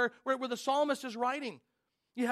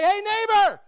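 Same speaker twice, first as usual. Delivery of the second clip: friendly call; 10 semitones higher, 15 dB louder, slower than usual. Retold or imitated. Calling out to a neighbor.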